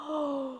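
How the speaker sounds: soft storybook gasp. Acted. Storyteller reacts to the prince's ball, gasping softly. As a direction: draw in an audible breath.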